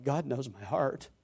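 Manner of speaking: hushed reverence at being fully known; fearful awe. Shown as a demonstration. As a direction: say it as a fearful, humbling truth. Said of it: "I find it more like".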